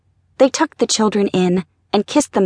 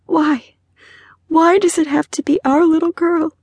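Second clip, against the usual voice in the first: high, tearful plea; voice breaking; 9 semitones higher than usual. A narrator's reading. Mother asking husband why their daughter, voice breaking with tears. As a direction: cry it out in anguish. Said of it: "Her voice broke with fresh tears".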